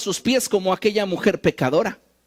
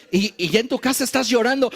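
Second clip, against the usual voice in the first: ordinary delivery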